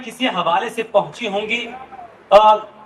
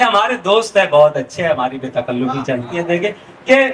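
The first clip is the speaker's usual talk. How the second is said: usual speaking voice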